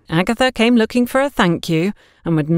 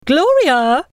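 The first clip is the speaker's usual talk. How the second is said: high, loud call across the shop; 10 semitones higher, 4 dB louder than usual. Acted. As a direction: call her name from across the room. Neighbour calls shopkeeper from afar.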